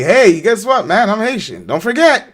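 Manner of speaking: hammed-up; mock announcement. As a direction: play a pushy self-announcer. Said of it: "I need to say every day".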